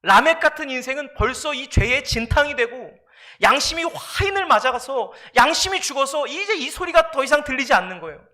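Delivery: usual speaking voice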